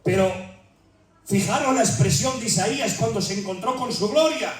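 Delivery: usual speaking voice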